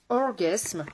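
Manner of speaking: drawn-out English-accented pronunciation. Shown as a demonstration. Makeup artist naming a blush shade to viewers, English accent. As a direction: say Orgasme slowly in an English accent. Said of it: "Quel accent anglais, j'adore".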